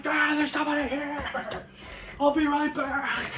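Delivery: high-pitched giddy dog voice; bursting with news, like a dog greeting company; high dog voice, stretching the last words; excited dog. Acted. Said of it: "He's so excited".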